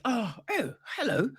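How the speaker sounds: high-pitched, fluttery British fussing; very British politeness. Acted. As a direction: play a terribly polite British host, voice way up high. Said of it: "it was very British".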